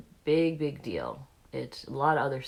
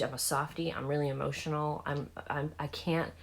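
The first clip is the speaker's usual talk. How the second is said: ordinary delivery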